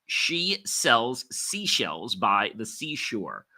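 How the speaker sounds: slow tongue twister, each word separated; deliberate articulation drill; slow and clear. Shown as a demonstration. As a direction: enunciate every word carefully, unhurried. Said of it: "Speak them slowly and clearly".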